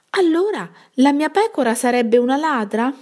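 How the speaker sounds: can't believe his sheep is a thief; shepherd shocked at the accusation; indignant disbelief. A narrator's reading.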